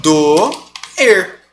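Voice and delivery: Do, er said as two separate syllables; syllables split apart. Shown as a demonstration. Teacher separates doer into two syllables for students. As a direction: break doer into Do and er. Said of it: "Como é que eu vou separar?".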